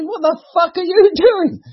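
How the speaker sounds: very high, slow, drawn-out shocked squeal; outraged shriek. Acted. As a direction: scream it in horror at dad. Daughter shrieks at father in joke.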